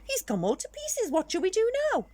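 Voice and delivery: anxious skeleton voice; worried skeleton. Acted. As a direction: fuss over the scattered bones. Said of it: "said the big skeleton".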